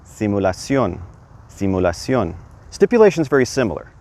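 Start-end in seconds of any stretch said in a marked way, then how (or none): 0.1-2.4 simulación as see-moo-la-SYON, stress on the final ón; Spanish pronunciation. Shown as a demonstration. Teacher repeats simulación so learners copy it. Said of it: "put an accent over the O".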